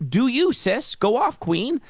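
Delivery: high, slow sarcastic "go off queen"; sassy mock approval. Sarcastic mock encouragement to rival.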